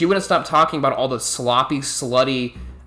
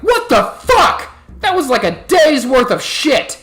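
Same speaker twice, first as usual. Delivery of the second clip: much higher, louder, furious shriek; outraged shriek; 12 semitones higher, 5 dB louder, slower than usual. Retold or imitated. Older coworker seeing the full waste bin, an outraged high yell. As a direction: explode at the wasted burgers.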